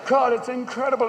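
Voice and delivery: ordinary talk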